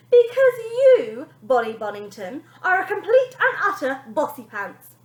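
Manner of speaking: put-on nanny branding the girl bossy; scornful nanny.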